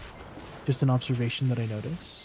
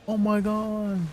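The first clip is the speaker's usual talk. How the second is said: starstruck; 7 semitones higher, slower than usual. Real, not acted. Spots a surprise guest in chat.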